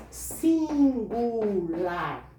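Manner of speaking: "Singular" dragged very slow; drawn-out emphasis. Shown as a demonstration. Teacher insisting the rule is singular only, very slow. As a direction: stretch every syllable of "Singular". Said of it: "Só no singular".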